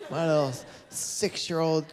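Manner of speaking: put-on high, slow cooing baby talk; sloppy tender drunk dad gushing. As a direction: coo over the phone like a sappy drunk dad.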